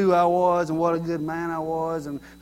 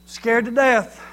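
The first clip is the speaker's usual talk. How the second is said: higher, louder exclamation of fright; mock fright; 4 semitones higher, 6 dB louder, slower than usual. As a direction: act out a startled everyday saying. Voicing a common saying.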